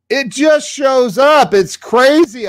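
high, loud burst of amazement; thrilled, ringing declaration; excited. Real, not acted. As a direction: shout it out in wonder. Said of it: "I don't know how".

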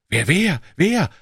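high, quick crowd questions; eager questioning. A narrator's reading. Twenty bystanders asking back who is coming, excited high questions. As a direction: pitch it up like many curious onlookers. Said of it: "fragten zwanzig andere dagegen".

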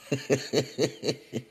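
soft run of chuckles; amused laugh. Real, not acted. Woman starting a grocery store story, amused chuckling. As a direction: chuckle softly at your own memory.

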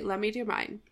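ordinary talk, nothing marked

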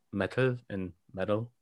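"metal" with a crisp T and then "metal" again, in a lightly put-on British voice; a light stab at British "metal"; British accent. Shown as a demonstration. Host attempting British "metal", which he calls terrible. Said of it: "something like".

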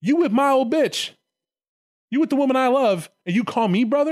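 high, indignant mock confrontation; hammed-up wounded outrage; betrayed brother. Acted brother confronting Lucifer over Lilith.

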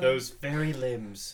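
drawn-out savoring; slow relishing mock gravity. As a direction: draw out every word with relish. Savouring the bad phrasing.

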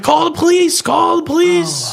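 a beaten man pleading for the cops; hammed-up high frantic yell; mock frantic shout. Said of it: "So I'm like".

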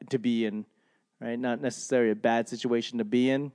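normal talking voice

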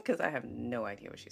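usual speaking voice

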